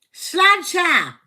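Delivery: loud, high toast shout; hearty toast. Real, not acted. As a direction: call out a rousing toast. Calls out a toast.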